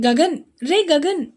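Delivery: calling Gagan urgently from a distance; shouting a name. Acted. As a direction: yell for his friend across the way. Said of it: "గట్టిగా అరుస్తూ పిలిచాడు".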